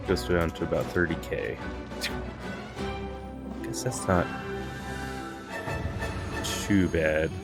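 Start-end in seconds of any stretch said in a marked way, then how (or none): none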